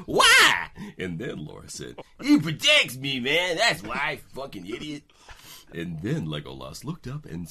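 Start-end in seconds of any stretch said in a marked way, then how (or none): 0.1-0.7 high squeak. Acted. Gandalf voiced in read story. 2.2-5.0 put-on high, loud cocky girl; loud brash Laura voice.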